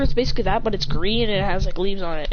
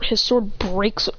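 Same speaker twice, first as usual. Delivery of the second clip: plain reading voice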